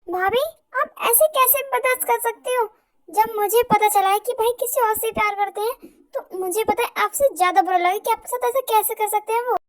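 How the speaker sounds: high, loud indignant outburst; outraged. Acted. Sister fuming to her sister-in-law about the brother, loud and high. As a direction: rage on her sister-in-law's behalf.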